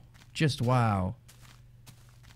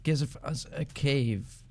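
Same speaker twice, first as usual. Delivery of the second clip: slow, stretched-out words; half-speed, deliberate begging; drawn-out plea; much slower than usual. Real, not acted. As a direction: spell out the plea word by word, very slowly.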